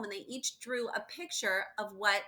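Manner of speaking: ordinary delivery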